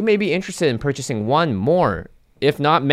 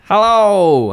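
big hello; 8 semitones higher, 9 dB louder than usual. Real, not acted. Hosts greet guest loudly.

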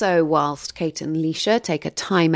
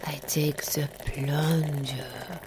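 whispering; 4 semitones lower, 8 dB quieter, slower than usual. Acted. Whispered aside while making coffee.

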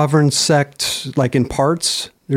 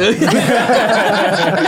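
laughing; 5 semitones higher than usual. Real, not acted. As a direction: burst of real laughter. Laughing at ill/ew mixup.

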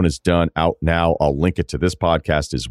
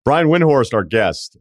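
announcer intro; 7 semitones higher, slower than usual. Acted. Announcing the guest.

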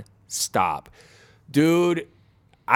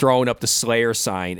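normal talking voice

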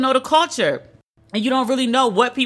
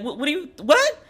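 sudden high-pitched what; baffled; 6 semitones higher than usual. Retold or imitated. Reacting to the guard's sunblock rule, high baffled yelp. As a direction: leap up in pitch, floored.

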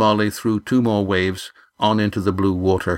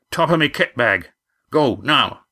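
raised, commanding skipper; urgent sailor voice; 5 semitones higher than usual. A narrator's reading. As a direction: bark the order, hurry him. Roaring Jack urgent order.

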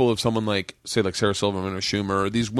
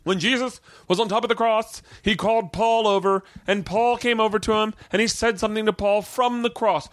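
higher, put-on lecturing teacher; teacher's preachy voice; 9 semitones higher than usual. Religion teacher quizzing the class, in a higher preachy voice. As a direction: play the pious religion teacher.

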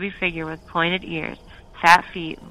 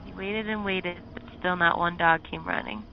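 usual speaking voice

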